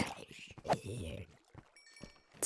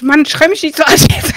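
startled loud outburst; 5 semitones higher, 11 dB louder than usual. Real, not acted. Startled by friend's yell.

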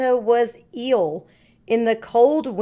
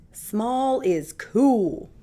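slow Santa voice; much slower than usual. Acted. Santa thanks Pete.

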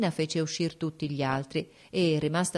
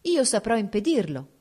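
quick, spirited, a little louder; lively reply; 5 semitones higher, 4 dB louder than usual. A narrator's reading. The duchess reassuring the princess, brisk and lively. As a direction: answer briskly and firmly. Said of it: "rispose vivamente la duchessa".

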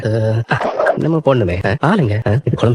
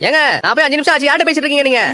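calling from afar; 11 semitones higher than usual. Acted. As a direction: shout back to the house from a distance. Calls from outside, far away. (தூரத்திலிருந்து சத்தமாக கூப்பிடுதல்)

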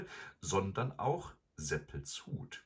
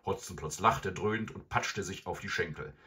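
ordinary delivery

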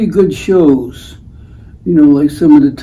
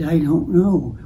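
quiet; 8 dB quieter than usual. Retold or imitated. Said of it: "he talks very, very quietly".

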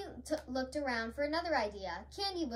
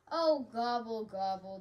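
loud "oh" sinking low; mild sigh of defeat; 3 semitones lower, 4 dB louder than usual. A narrator's reading. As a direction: let it sink away like a sigh. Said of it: "Turkey sighed".

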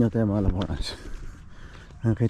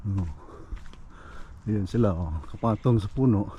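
ordinary delivery

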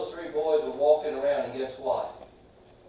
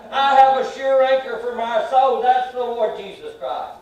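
loud, higher proclamation of hope; full-force preaching; 5 semitones higher, 7 dB louder than usual. Acted. Preacher to the church on his sure hope, loud and fervent. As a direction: ring out the anchor of your soul.

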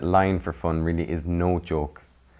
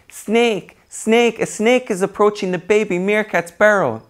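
high, loud put-on alarm call; barking false alarm; 9 dB louder than usual. Millie tricking the colony again, high loud alarm. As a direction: cry wolf in a high bright voice. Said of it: "barked out again".

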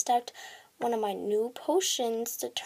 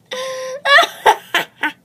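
loud high villain cackle; evil laugh; 11 semitones higher, 10 dB louder than usual. Acted. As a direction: cackle wickedly. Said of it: "do an evil laugh after every sentence".

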